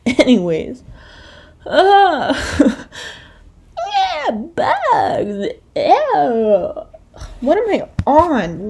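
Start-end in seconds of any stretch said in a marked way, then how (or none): 1.6-8.7 giggly high voice. Real, not acted. Laughs at own outburst.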